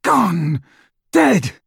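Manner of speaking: high, forceful cries of grief; anguished outburst. A narrator's reading. Snape grieving Lily to Dumbledore, anguished. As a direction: throw each word out in anguish.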